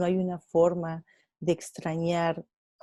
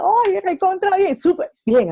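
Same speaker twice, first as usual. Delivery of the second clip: much higher and loud, over-the-top cheery; fake cheerful answer; 13 semitones higher, 10 dB louder than usual. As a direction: chirp it out as a blatantly false happy answer. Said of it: "Aquí no es coherente".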